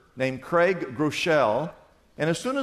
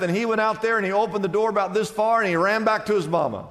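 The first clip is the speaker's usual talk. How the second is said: ordinary talk, nothing marked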